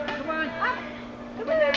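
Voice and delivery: normal talking voice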